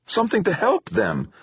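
high-pitched urgent plea; desperate plea. A narrator's reading. Son begging father to help victims, desperate.

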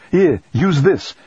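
high, slow, called-out offer; urgent call. A narrator's reading. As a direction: call it over the noise. Handing over a mask amid fire.

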